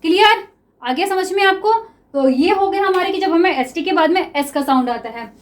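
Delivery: ordinary talk, nothing marked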